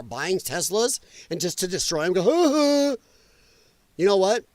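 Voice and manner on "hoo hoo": high, silly "hoo hoo"; put-on giddy hoot; mocking gleeful hoot. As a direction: mimic an idiot's gleeful hooting. Mimics gleeful vandals destroying Teslas.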